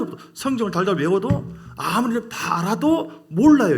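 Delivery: loud, high, impassioned sermon climax; fiery preaching. Acted. 회중에게 외치는 목사, 열정적인 설교. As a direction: preach it with full force to the congregation.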